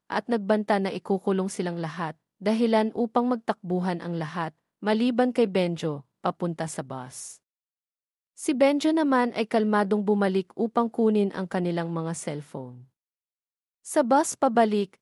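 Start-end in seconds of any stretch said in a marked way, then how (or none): none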